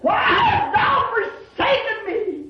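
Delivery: high, impassioned preacher's cry; ringing, high-pitched cry of the forsaken; anguished quoted cry. Retold or imitated. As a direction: cry it out high, as a desperate plea. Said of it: "that's why he cried".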